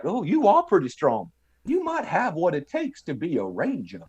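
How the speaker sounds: lilting, swooping mock Southern drawl. Beaten clerk praising the recruit, grating Southern drawl. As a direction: admire his strength in an exaggerated Southern drawl. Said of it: "that Southern drawl accent".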